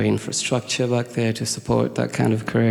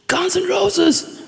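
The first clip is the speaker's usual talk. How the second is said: mock shout; much slower than usual. Teen yelling band name at friend, mock shout. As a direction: stretch it out in a squeaky cry. Final shouted quarrel line.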